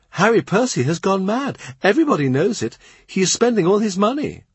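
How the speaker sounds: high-voiced court girl sharing gossip; gossiping young lady. A narrator's reading. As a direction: spill the news with relish. Narrator voices Mary Shelton.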